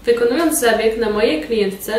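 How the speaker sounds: normal talking voice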